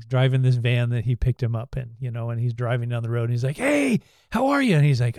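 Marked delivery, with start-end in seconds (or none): 3.6-4.8 high, bright mock greeting; chirpy hello from the van driver; cheerful greeting. Retold movie dialogue, van driver.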